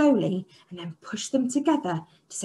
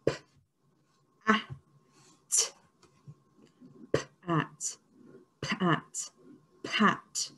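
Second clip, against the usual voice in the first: p, at, whispered softly; soft whisper before the reveal; whispered sounds; 4 semitones lower, 7 dB quieter than usual. Shown as a demonstration. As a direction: hush it to build suspense. Teacher whispers sounds to build suspense.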